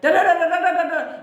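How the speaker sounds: mocking a pushy sibling's rant. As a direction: parrot a sibling going on and on. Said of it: "sister or whatever is like".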